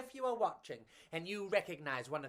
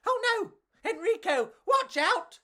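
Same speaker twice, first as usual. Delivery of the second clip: high, louder alarmed bug cry; alarmed warning; 9 dB louder than usual. Acted. Pedro warning Enrico of dropping ice cream, high alarmed cry. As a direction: warn him, ice cream is falling.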